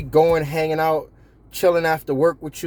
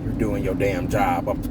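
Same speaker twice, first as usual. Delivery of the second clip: mock awkward boss; 5 semitones lower than usual. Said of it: "I'm being funny saying it like that".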